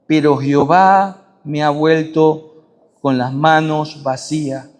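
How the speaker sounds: low, steady Bible reading; scripture reading. Acted. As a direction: read the passage low and measured. Reads Ruth chapter one.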